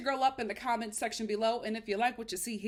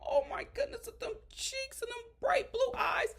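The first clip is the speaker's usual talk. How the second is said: gushing over cuteness; 12 semitones higher than usual. Real, not acted. Admiring the child on the show, high cooing gush. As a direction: melt over those cheeks, pitched way up.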